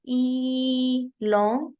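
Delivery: ई held long and very slow. Shown as a demonstration. Teacher holding ई long to show a long vowel. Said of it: "एक ही long sound है".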